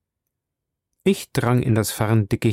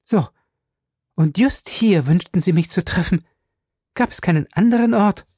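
high, mocking woman's voice rising; scornful; 8 semitones higher than usual. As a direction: sneer at the meeting place. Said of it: "Höhnisch wies sie auf das Scheunendach". (höhnisch, hohe Frauenstimme)

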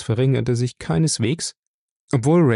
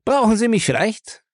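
aggressive mocking question; 11 semitones higher than usual. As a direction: taunt him, much higher. Angry drunk confronts detective. (höhnisch herausfordernde Frage)

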